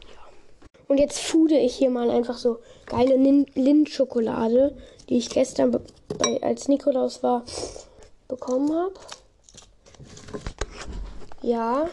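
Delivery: ordinary talk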